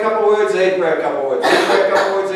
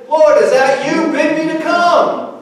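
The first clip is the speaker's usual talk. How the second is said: higher, louder, slowed call across the water; Peter calling out; 8 semitones higher, 4 dB louder than usual. Retold or imitated. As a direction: call out hopefully to Jesus. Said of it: "And Peter said".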